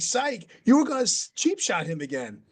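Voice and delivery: very high voice, PSYCH swooping up; mock incredulity. Everyone at the table doubting the fake-out, high mock PSYCH. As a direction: imitate a crowd disbelieving the word PSYCH. Everyone echoes the excuse in disbelief.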